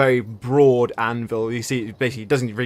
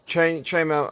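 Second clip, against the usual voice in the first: ordinary talk, nothing marked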